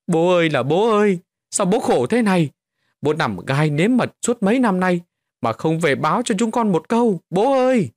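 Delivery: high, loud keening for father; wailing lament. A narrator's reading. Daughters wailing over father's nailed corpse, keening. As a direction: wail for the dead father. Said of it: "bắt đầu than khóc".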